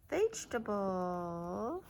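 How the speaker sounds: ordinary talk, nothing marked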